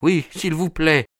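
high, anxious character voice; timid man's worried plea; frightened plea. A narrator's reading. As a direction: beg nervously, pitched well up. Terrified Thaddeus begs Holmes.

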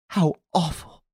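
high, appalled exclamation; horrified. A narrator's reading. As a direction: recoil at the sight. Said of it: "turning faint with horror".

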